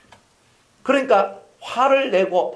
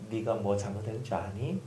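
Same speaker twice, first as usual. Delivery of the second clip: put-on soft, unhurried question to a child; calm gentle question; 9 semitones lower, 13 dB quieter than usual. Shown as a demonstration. Pastor showing parents how to question a child, soft and calm. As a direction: ask it gently, like a patient parent. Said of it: "이렇게 질문을 하면서".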